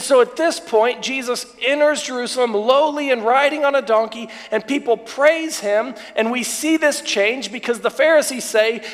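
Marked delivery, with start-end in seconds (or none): none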